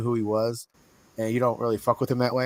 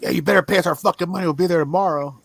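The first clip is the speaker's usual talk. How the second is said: raised, loud imitation of a debt collector; mock threat; 7 semitones higher, 8 dB louder than usual. Mafia landlords demanding money from tenant, mock threat. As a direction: act out a pushy mob demand, high and loud.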